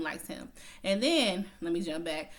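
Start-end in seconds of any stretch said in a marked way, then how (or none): 0.0-0.5 quiet knowing echo of likes him; soft echo. Shown as a demonstration. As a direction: repeat likes him softer and lower. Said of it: "likes him (echoed)".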